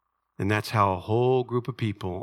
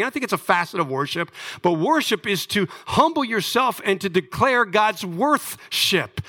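ordinary delivery

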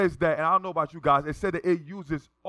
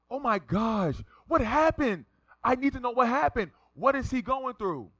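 high-pitched nosy onlooker in a panic; hammed-up urgent questioning; mock alarm; 10 semitones higher than usual. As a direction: act the over-concerned passenger, pitched way up. Imagined alarmed passenger's reaction.

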